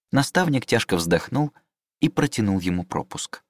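plain reading voice

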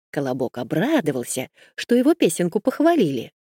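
ordinary delivery

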